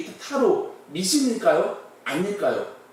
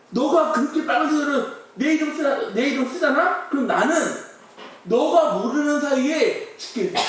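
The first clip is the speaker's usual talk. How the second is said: mock dire warning; 6 semitones higher than usual. Scientist warns of death, laughing.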